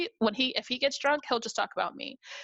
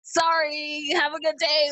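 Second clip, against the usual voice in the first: put-on flustered boyfriend; 6 semitones higher, 5 dB louder, slower than usual.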